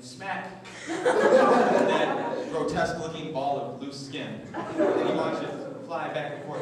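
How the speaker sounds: slow, loud words pushed out through laughter; can barely finish for laughing. Real, not acted. Comic to crowd, cracking up at own gross joke.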